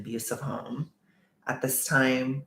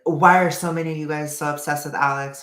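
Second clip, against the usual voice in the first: a retold pissed-off rant, pitched higher and louder; mock his irritated outburst; angry online rant, imitated; 4 semitones higher, 7 dB louder than usual. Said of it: "he was really pissed".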